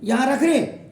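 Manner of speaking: ordinary talk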